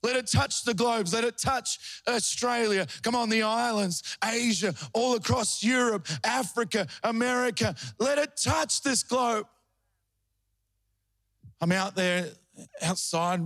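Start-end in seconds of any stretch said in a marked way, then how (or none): 0.0-9.5 high, ringing prayer calling over the nations; fervent prayer. Retold or imitated. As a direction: pray it out like a revival preacher, pitched up. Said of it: "I said".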